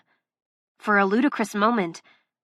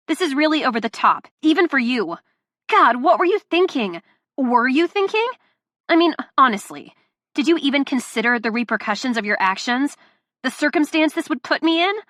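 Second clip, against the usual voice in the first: loud, raised, furious listing; angry rant; 4 semitones higher, 3 dB louder than usual. Acted. Delilah berating Luca, angry and loud. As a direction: tear into him. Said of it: "this rant".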